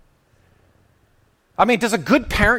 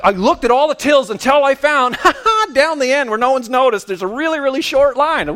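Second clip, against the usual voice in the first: higher, louder gloating glee; gleeful; 7 semitones higher, 4 dB louder than usual. Retold or imitated. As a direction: crow over finding the short line. Gleeful retold dash to short till.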